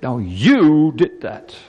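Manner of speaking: slow accusation leaning hard on YOU; mock harsh accusation. God with a big stick blaming Adam, mock harsh. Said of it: "God didn't take out a big stick".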